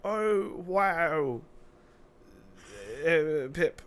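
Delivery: a hint of a mock Irish friend; bad Irish accent. Friend reacting, in a weak mock Irish accent. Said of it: "I can't do an Irish accent".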